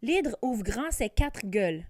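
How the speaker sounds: plain reading voice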